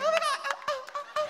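very high hammed-up moaning. Katie moaning back, high mock falsetto. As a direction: moan it in a squeaky mock falsetto. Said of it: "And you guys are like".

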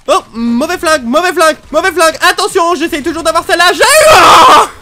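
high, loud frantic repetition building to a scream; frantic gamer shriek; panicked shouting. Real, not acted. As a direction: yell in mounting panic. Panicking with the wrong gun.

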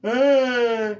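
sudden high loud yelp; startled scream. Real, not acted. Reacts to the character's face.

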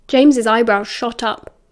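plain reading voice

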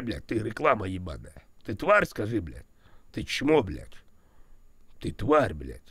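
normal talking voice

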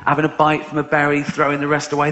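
ordinary delivery

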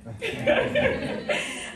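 bright laugh; laughing at the crowd; high laugh. Real, not acted. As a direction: laugh off your own joke. Laughs after joke.

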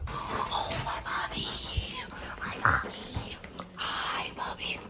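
soft unvoiced whisper to a pet; hushed, tender whisper. Real, not acted.

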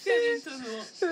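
laughing. Real, not acted. Just lost the challenge.

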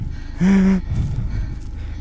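sharp breathless gasp; fearful gasp. Real, not acted. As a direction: gasp in fright. Fright during game.

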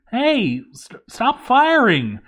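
high, loud, stammering plea to stop; panicked shout. Acted. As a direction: yell up in alarm, tripping over the words. Dwarf under arrow fire calls out.

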